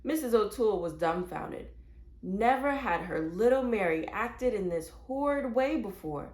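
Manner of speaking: ordinary delivery, nothing marked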